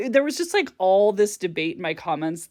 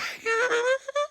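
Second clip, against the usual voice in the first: short, high embarrassed giggle. Real, not acted. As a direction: giggle at yourself. Laughing at own habit.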